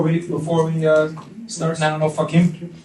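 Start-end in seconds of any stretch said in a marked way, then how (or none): none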